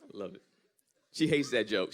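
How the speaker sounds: ordinary talk, nothing marked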